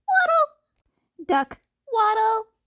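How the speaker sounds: high, drawn-out goofy voice; high comic waddle call; silly waddle. Acted. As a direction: sing it like a cartoon. Duck waddling.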